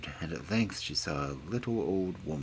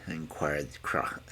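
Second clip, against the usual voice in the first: ordinary delivery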